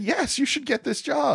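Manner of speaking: high, mock-cheery voice of the friends; mock chorus of friends. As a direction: chirp it like approving friends. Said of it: "they all said".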